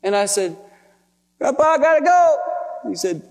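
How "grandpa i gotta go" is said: high, loud, choked-up farewell; acted tearful goodbye. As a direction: imitate talking through tears. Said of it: "we're crying so hard".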